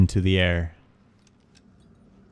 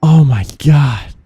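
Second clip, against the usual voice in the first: shaken; 6 semitones higher, 5 dB louder than usual. Real, not acted. After jump scare, still rattled.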